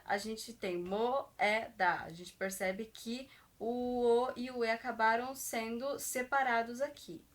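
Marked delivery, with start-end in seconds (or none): 0.0-2.0 moeda drawn out slowly, syllable by syllable; syllables split apart. Shown as a demonstration. Teacher slowly splitting moeda for students. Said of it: "mas quando a gente separa".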